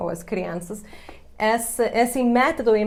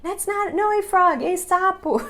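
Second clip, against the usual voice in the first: high, mock-frustrated kid voice; frustrated child; 11 semitones higher, 3 dB louder than usual. Mother imitating her frustrated son correcting her. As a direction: imitate a stubborn little boy correcting mom. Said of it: "ele ficava frustrado comigo".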